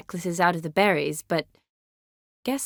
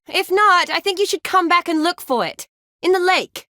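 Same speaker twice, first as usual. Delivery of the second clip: raised, high projected call; loud, high-pitched holler; 10 semitones higher, 6 dB louder than usual. Acted. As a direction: call it out across a distance. Calling out to someone at a distance.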